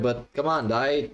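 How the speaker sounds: ordinary talk, nothing marked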